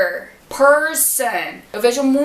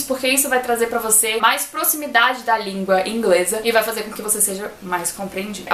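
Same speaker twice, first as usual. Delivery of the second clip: ordinary delivery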